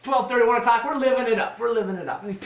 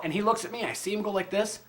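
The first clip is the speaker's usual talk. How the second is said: ordinary delivery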